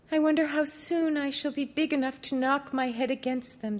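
a hint of a wistful young princess. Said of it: "said she".

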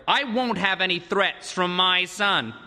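indignant parent, each word weighed; stern rebuke. A narrator's reading. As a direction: lay down the law to a son. Father rebukes threatening son.

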